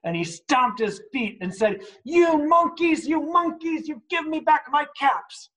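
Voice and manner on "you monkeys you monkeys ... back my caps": much higher, shouted fury; really angry shout. Acted. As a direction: stamp and yell at the monkeys. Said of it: "really, really angry".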